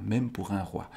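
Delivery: plain reading voice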